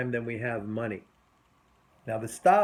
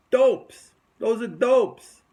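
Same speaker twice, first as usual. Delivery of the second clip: scornful shout; 6 semitones higher, 7 dB louder, slower than usual. Real, not acted. Coach scorning distracted traders.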